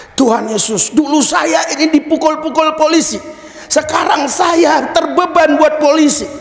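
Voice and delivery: pleading prayer, held high; fervent prayer. Retold or imitated. Preacher recounts praying to Jesus about the police, fervent high prayer. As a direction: cry out the prayer on a sustained high pitch.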